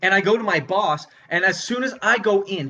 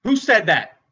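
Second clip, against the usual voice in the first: controlled, tense demand; confronting, not yet shouting; challenging heckler. Acted. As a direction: call out the heckler, holding back. Comedian challenges heckler, first take.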